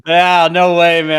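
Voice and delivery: loud, high disbelief; loud exclamation. Real, not acted. Laughing reaction to French joke.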